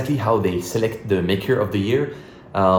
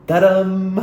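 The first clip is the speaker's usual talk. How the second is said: high, louder triumphant ta-dam; ta-dam reveal; 9 semitones higher, 6 dB louder than usual. Real, not acted. Unboxing the award package on camera, triumphant reveal. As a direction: announce the surprise with a flourish.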